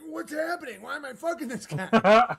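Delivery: high, loud bewildered cry; hammed-up panic of a confused fighter; mock confused fighter. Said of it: "it's like".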